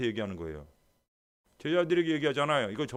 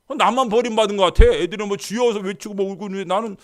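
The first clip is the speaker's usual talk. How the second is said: wounded complaint; 7 semitones higher, 12 dB louder than usual. Retold or imitated. Left-out believer complaining.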